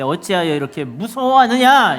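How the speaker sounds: loud rebuke, building on the last word; rebuking. Acted. Preacher voices Jesus rebuking the disciples, loud rebuke. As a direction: challenge them sternly for their fear. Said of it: "바다만 꾸짖은 것이 아니라".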